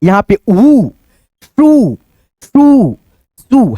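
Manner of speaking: श्रू with the ऊ pulled long; long श्रू, vowel held; drawn-out long uu. Shown as a demonstration. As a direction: stretch the ऊ in श्रू. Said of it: "फिर यहाँ पे खींचेंगे".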